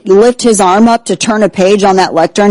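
usual speaking voice

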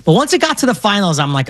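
usual speaking voice

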